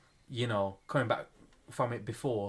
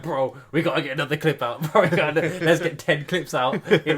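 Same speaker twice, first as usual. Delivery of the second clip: excited imitation; 4 semitones higher, 9 dB louder than usual. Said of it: "he was like".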